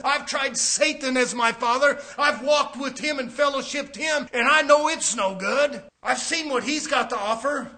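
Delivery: loud, fervent testimony; testimony of a former sinner. Retold or imitated. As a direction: declare it as one who has seen the dark. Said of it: "You say".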